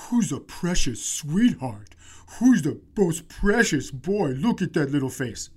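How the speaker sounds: mock gushing over a precious pet; imitating doting baby talk. Man gushing over a dog.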